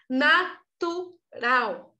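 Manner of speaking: "Natural" very slow, in three separated syllables. Real, not acted. Teacher insisting it comes naturally to children, "Natural" syllable by syllable. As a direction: stretch out each syllable of "Natural" for emphasis. Stretches the word to make her point.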